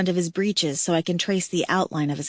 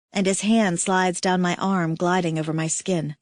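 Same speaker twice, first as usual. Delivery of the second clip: ordinary delivery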